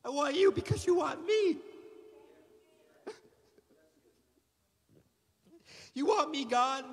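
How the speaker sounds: impassioned shouted prayer; crying out to God. Retold or imitated. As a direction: call out to God like a boy breaking.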